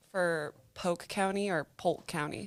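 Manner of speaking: POLK first as "poke", then with the L heard; L dropped, then sounded. Shown as a demonstration. Co-host asks which pronunciation, contrasting Polk. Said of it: "You say the L?".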